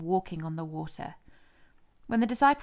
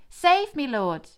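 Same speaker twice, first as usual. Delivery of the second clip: much higher, loud cry for help; shouted plea; 7 semitones higher, 8 dB louder, much slower than usual. A narrator's reading. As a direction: yell it out while sinking. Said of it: "he shouted".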